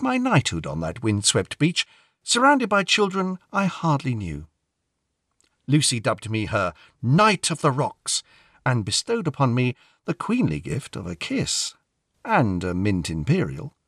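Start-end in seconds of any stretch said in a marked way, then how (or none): none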